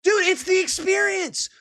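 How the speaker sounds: high, incredulous cry; exasperated cry at the news clip; exasperated outburst. Real, not acted. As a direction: throw it up high in disbelief.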